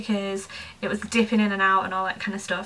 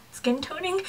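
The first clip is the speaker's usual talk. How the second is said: higher, rising, unsure read-back; puzzled questioning; 7 semitones higher, 3 dB quieter than usual. Real, not acted. Vlogger doubting the product name on the label, puzzled questioning. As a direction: query the words as you read them.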